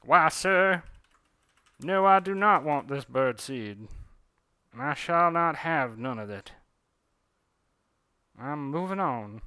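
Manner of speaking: put-on loud, proud Southern accent, drawn out slowly; an indignant Southern bird refusing birdseed, Southern accent. A bird refusing the birdseed, loud and slow in a Southern accent. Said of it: "that's how all birds in the South talk".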